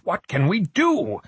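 high, drawn-out helpless question; frustrated. A narrator's reading. Frock asks Margot for help, frustrated and high. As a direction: plead slowly in frustration. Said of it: "trembling with frustration".

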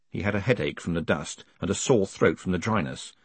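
ordinary delivery, nothing marked

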